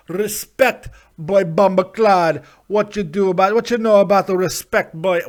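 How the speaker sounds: loud, hammed-up Jamaican patois; put-on Jamaican accent. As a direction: boom it out in a mock Jamaican accent. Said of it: "I don't know why I'm going Jamaican".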